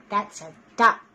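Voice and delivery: ordinary delivery, nothing marked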